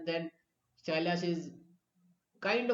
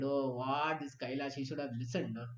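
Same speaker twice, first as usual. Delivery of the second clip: dejected sage; 6 semitones lower, 6 dB quieter than usual. Retold or imitated. Teacher voicing the sage sad over the lost devotee. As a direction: lower and sad, shaking your head. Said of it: "Narad Muni becomes dejected".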